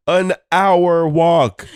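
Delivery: high, loud, very slow stretch; drawn-out complaint. Real, not acted. Complaining to the cohost about the walk, stretched out. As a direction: drag out hour walk in disbelief.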